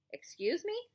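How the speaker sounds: high, cold question; sharp challenge. Acted. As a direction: demand he repeat it. Warden challenging.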